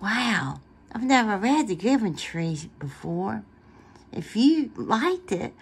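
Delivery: ordinary delivery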